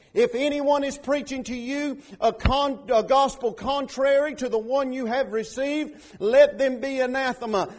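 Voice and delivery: loud, driving sermon delivery; fiery preaching. Acted. Pastor preaching at full force to the church. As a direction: preach it hard to the congregation.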